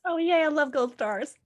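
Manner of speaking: high, giddy cry of joy; delighted squeal. Real, not acted. Cohost thrilled by the gold stars, high and giddy.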